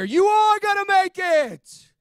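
slow, high, booming shout; soaring shouted promise, every word stretched; God's voice proclaimed. Retold or imitated. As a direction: belt it out as a triumphant word from God. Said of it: "I heard that still small voice".

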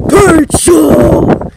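pizza yelled high and loud with glee; excited shout. Real, not acted. Kid on the way to John's shouting for pizza, excited.

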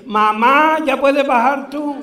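loud, high call, drawn out at the end; high-pitched shout across a distance; old woman calling back. Retold or imitated. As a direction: holler back up to mother on the ship. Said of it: "se vira para atrás y dice".

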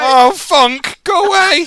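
high, loud panicked shout; panicked yell. Real, not acted. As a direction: scream at the chaser to leave. Being chased in game.

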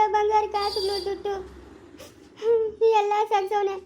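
slow, sobbing through the words; crying. Acted. Wife weeping to her sister-in-law over her husband's hard work, sobbing. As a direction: weep as you list what he earned. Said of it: "ಯಾಕೆ ಅಳ್ತಾ ಇರೋದು ನೀನು?".

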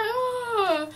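high drawn-out "ooh" of pain; mock pained wail. Retelling a curling iron burn, mock wailing.

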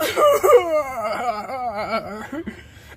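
long, loud theatrical sobbing; loud bawling. Acted. Overcome after confessing to friends, loud sobbing. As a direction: bawl out a long run of sobs.

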